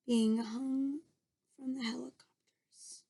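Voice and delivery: hushed breathy whisper. Real, not acted. Reader whispers to the children, showing a picture. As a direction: drop to a secret whisper. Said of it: "whispered aside".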